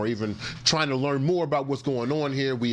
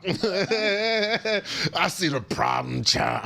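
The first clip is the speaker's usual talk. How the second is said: high, very slow drawn-out voice; problem child voice; 6 semitones higher, much slower than usual. Pretend. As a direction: stretch it out in a put-on voice. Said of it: "I'm trying to do the problem child voice".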